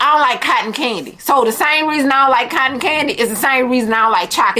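ordinary delivery

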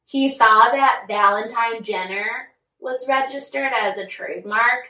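usual speaking voice

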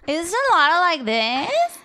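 put-on Valley Girl vocal fry with a rise; creaky vocal fry, lifting at the end. Shown as a demonstration. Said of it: "Vocal fry".

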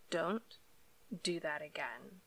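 quiet, stern warning. A narrator's reading. Mermaid warning the man she ducked, quiet and stern. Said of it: "she said sternly".

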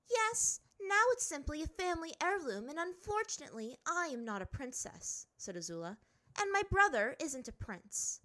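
high, sharp princess voice; a proud girl brushing it off; haughty princess. A narrator's reading. Azula speaks haughtily.

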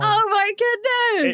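high, drawn-out "oh my goodness"; amazed exclaim. Real, not acted. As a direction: stretch it out in delight. Correct answer guessed.

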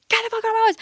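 high-pitched scream held back in volume; mock scream. Girlfriend retelling throwing her ex out, mock scream. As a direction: squeal it as a restrained re-enactment. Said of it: "I was, like, screaming".

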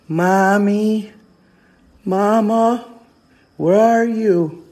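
put-on high, drawn-out little girl calling; little girl's voice. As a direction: imitate a lost child calling for mother. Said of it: "this little girl's voice".

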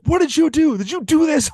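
high and loud, accusing; mock shouted accusation. Imitating his shocked reaction to the film, high mock shouting. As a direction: yell it like you caught them.

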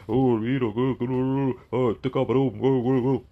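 loud, sputtering parody of an upset commenter; mock outrage. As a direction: ham up a commenter who can't get his words out for anger.

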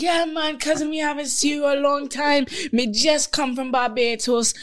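high, hammed-up Bajan dad greeting a stranger; dad's Bajan accent. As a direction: egg it on like dad in a thick island accent. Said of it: "my dad over there instigate".

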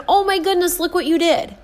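put-on high sing-song praise for a toddler; praising a small child. Adult gushing to a little child, high and delighted. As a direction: gush over a little one, high and bright. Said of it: "just wants to hear".

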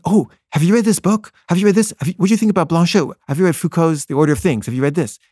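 high, eager rapid-fire questions; over-excited questioning. Retold or imitated. Interviewer keeps interrupting the poet with book questions, excited. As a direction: pile question on question, starting high and easing down. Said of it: "I just was so excited".

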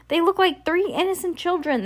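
high-pitched, excitable shopkeeper voice; agitated shopkeeper. A narrator's reading. Shopkeeper describes the children to the delivery person, agitated. Shopkeeper calls back across aisles.

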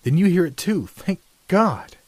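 high, loud burst of earnest relief; earnest, pitched well up, emphatic. A narrator's reading. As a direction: seize on it with heartfelt urgency. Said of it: "Marriott said earnestly".

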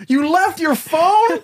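high, slow, stunned disbelief; shocked disbelief. Acted. Friend hears the phone was left behind, high stunned disbelief. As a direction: stretch it out in total disbelief. Incredulous high mock reaction.

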